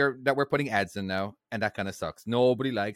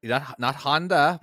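ordinary delivery

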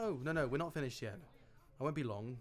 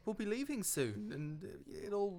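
reassuring; 8 semitones higher than usual. Acted. Calming his upset wife.

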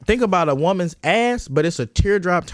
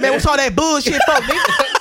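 higher-pitched mock tough challenge; 7 semitones higher than usual. Confronting the guy talking like that, mock tough voice. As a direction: play a put-on tough guy confronting him.

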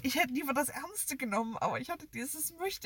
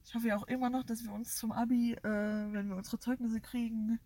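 normal talking voice

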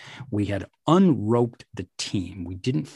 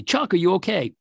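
retold yell from team; 9 semitones higher, 4 dB louder, faster than usual. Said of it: "They yelled down to me".

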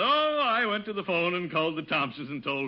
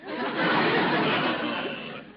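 breathy embarrassed chuckle; 4 dB louder than usual. Acted. Gildersleeve covers embarrassment.

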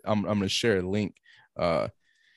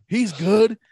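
high, loud mock London bluster; hammed-up British tough guy, pitched up; Eddie Hall; 9 semitones higher, 6 dB louder than usual. Eddie Hall insisting his son is fine, loud mock bluster. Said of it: "That's how I was doing his voice".

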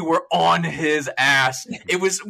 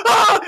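high, loud shriek of fright; terrified scream; 9 dB louder than usual. Retold or imitated. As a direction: scream at the scuttling crab. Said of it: "and I'm like".

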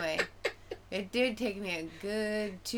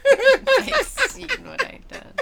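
high, slow words broken by laughter; helpless laughter; 14 dB louder, slower than usual. Real, not acted. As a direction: squeeze the line out while cracking up. Cracking up while repeating the line.

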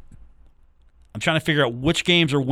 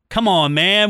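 loud, high come on man; catchphrase come on man; 7 semitones higher, 7 dB louder than usual. Shown as a demonstration. Host blurting the banned catchphrase, loud and high. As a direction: belt out Come on, man with a rise. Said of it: "What can I say then if I can't say".

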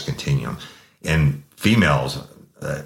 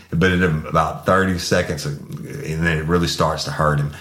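normal talking voice

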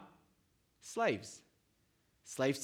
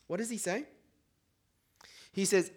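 ordinary talk

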